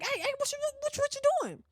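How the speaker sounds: high, alarmed yelp of disbelief; sudden fright in the car; retold panic. Panicking as grandma opens door.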